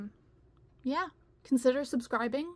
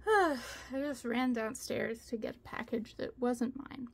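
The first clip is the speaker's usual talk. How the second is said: ordinary talk, nothing marked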